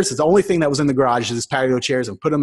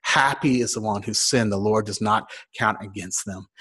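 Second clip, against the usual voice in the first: scripture reading; 5 semitones lower, 6 dB quieter than usual. Acted. Reads a scripture verse.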